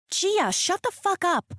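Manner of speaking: high, furious yell at her sister; shouting. A narrator's reading. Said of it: "I shout".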